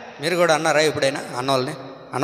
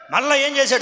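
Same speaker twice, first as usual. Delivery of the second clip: ordinary delivery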